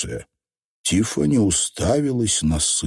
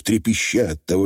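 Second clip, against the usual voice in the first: ordinary delivery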